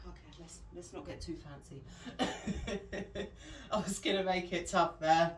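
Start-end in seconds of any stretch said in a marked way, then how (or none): 1.9-3.7 light chuckle rising at the end; laugh. Real, not acted. Coach backing off getting too fancy, chuckling laugh. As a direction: chuckle at your own idea.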